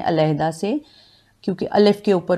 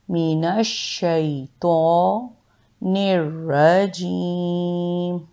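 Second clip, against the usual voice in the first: slow, drawn-out chanted recitation; slow Quranic recitation; much slower than usual. Acted. Teacher reciting the full ta'awwudh for students. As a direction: recite it slowly and with care.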